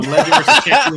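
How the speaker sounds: said loud and high through laughter; laughing. Real, not acted. Reading the card to his co-host, laughing. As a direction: crack up reading the matchup.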